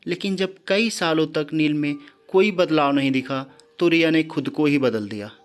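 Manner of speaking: ordinary delivery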